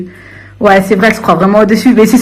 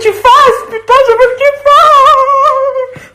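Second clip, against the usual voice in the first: hysterical shrieking; 13 semitones higher, much slower than usual. Real, not acted. Ranting at a rival on live, shrieking high. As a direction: voice climbing into a high, stretched shriek. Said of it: "Calme-toi".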